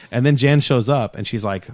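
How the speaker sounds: ordinary talk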